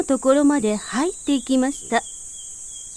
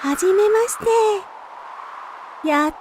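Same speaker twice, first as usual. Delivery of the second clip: higher light wind voice; breezy wind; 6 semitones higher, faster than usual. A narrator's reading. Wind meeting the bud, higher light greeting.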